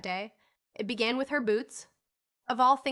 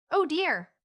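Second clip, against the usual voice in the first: high, louder dismayed oh dear; dismayed sigh; 7 semitones higher, 6 dB louder than usual. A narrator's reading. Girl fighting her boot buttons exclaims in dismay. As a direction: groan it in frustration. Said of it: "said Polly".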